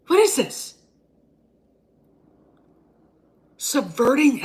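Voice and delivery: a hammed-up explosion at a screening; pretend outraged rant. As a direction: fan blowing up at the screen, loud and outraged. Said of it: "this is me if I'd had gotten The Last Jedi shoved in my face".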